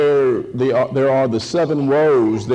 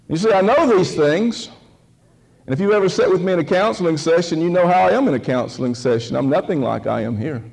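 ordinary talk

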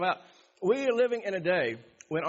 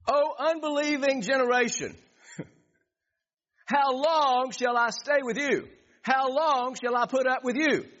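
higher, slow exasperated rebuke; Jesus' rebuke; 7 semitones higher, 3 dB louder than usual. Acted. Preacher voicing Jesus rebuking an unbelieving generation, exasperated. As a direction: voice Jesus scolding the crowd.

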